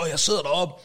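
usual speaking voice

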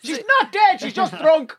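mock detective. Mock CSI detective line.

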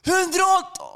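very high, hollered call; acted-out yell at a passenger; comic shouting. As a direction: holler it at the top of your pitch. Last shouted speed before police appear.

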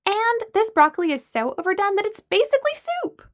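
high-pitched, exasperated insistence; worked-up high objection; insistent complaint. A narrator's reading. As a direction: press the complaint, voice climbing. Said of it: "Lana insisted".

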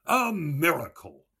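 high, loud exclamation; mock-amazed cry. A narrator's reading. Mulligan finds a coin in his pocket, crying out loudly. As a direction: cry it out like a showman. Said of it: "twisted it round in his fingers and cried".

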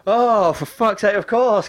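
high, drawn-out mock groan of frustration; high-pitched retold exasperation; exasperated. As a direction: stretch the opening out in exasperation. Caller is transferred yet again.

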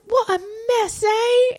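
high, gentle mock of a kindly nun; tender nun voice. Nun cooing over Rhoda's baby, high and tender. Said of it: "and she says".

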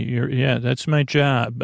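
ordinary delivery

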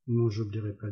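ordinary delivery

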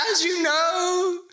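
high, slow, pompous lord's proclamation; warm regal boom. Acted. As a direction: announce yourself grandly to your subjects. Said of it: "a rich melodious voice".

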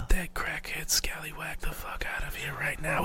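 unvoiced whisper with a grin; whispered order to get the guest out. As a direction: hiss it so the guest can't hear. Whispering to partner during recording.

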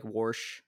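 "warsh", an intruded r as in "war"; regional pronunciation. Retold or imitated. Host imitating people from Washington, "warsh" with an added r. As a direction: say "warsh" with an r before the sh. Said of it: "people from Washington who say".

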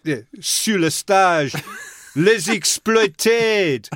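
hammed-up French MC; mock announcer. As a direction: stretch out the band name like a showman. Mock announcer introducing the band.

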